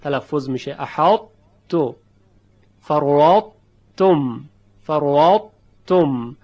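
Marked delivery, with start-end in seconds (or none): 0.8-1.9 احاط تو with a thick, heavy ط before the ت; exaggerated partial merge, heavy ط; heavy ta kept. Shown as a demonstration. 2.8-6.4 فروات تم with a thin, light ت, loud and clear; exaggerated light ت for contrast. Shown as a demonstration. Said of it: "یک حرف ت دو نقطهٔ مرقق".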